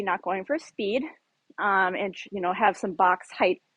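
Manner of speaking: ordinary talk, nothing marked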